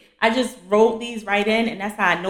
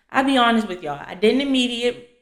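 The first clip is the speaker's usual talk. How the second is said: normal talking voice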